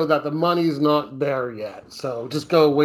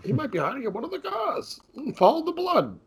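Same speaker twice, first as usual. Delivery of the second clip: lightly voiced cop, higher pitch; high mock cop voice; 11 semitones higher than usual. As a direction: play a forties beat cop, pitched up. Cops voiced in retelling.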